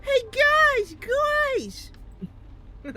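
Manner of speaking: high, tipsy call to his friends; drunk calling out. Acted. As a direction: shout for the gang like a happy drunk. Drunk Ultra Pink calls friends.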